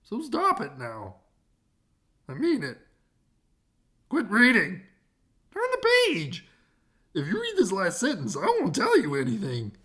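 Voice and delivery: annoyed narrator scolding the reader, rising loud; cranky narrator. Acted. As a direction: snap at the reader to stop.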